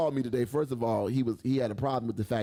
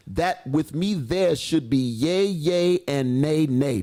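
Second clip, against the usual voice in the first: slow, formal scripture reading; measured old-Bible recitation; King James reading; 3 semitones higher, 7 dB louder, slower than usual. Acted. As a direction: give each archaic phrase its weight. Reads King James aloud.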